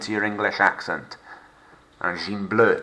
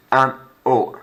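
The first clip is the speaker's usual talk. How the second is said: ordinary delivery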